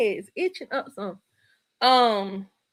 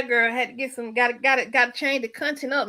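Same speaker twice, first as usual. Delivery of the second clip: normal talking voice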